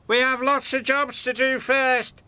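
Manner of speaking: high, loud, busy little engine; peeping little engine. A narrator's reading. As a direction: peep it out, a touch anxious. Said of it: "peeped Percy".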